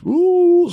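a sudden squeaky ooh, way up high. Retold or imitated. Host doing the candy drop, a high squeal of ooh. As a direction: let out a high, loud ooh.

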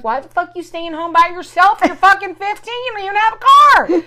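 mock furious shout at the teen; incredulous yell. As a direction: yell at her in disbelief. Outraged shouting at teen daughter.

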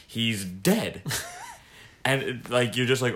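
ordinary delivery

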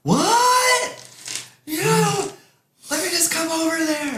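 very high, strained call across a distance; mock distant shouting. Game master jokes about shouting across the cavern, high mock holler.

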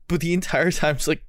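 ordinary talk

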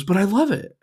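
high, loud imitation of a pleading kid; pleading young son. Son begs dad for the movie, pleading child voice. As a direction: mimic a small boy protesting. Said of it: "And he goes".

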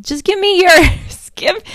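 high, loud plea breaking into a laugh. Retold or imitated. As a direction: laugh through the demand. Laughing while quoting herself.

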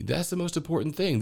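a lightly voiced, cocky Jesus claiming the point; his own Jesus. Speaker voicing Jesus his own way, lightly brash. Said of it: "I would have said".

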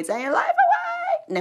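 very high, loud, drawn-out singsong; high mock squeal. Joking about FBI contract, squealed mock warning.

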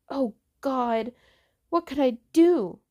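high, loud cry of despair; desperate. A narrator's reading. Murderer cannot drown out the growing noise, desperate. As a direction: cry out helplessly, rising in pitch and volume.

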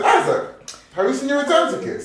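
high, loud imitation of an alarmed friend; mock-urgent question; urgent friend's warning.

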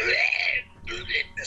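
high-pitched imitated retching noise; mock vomiting. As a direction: act out throwing up with squealed blehs. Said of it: "I start throwing up in the sink".

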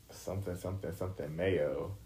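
low, soft, offhand mumble; mumbled imitation. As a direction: gloss over it under your breath. Imitating friend's half-heard ingredient list.

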